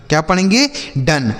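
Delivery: usual speaking voice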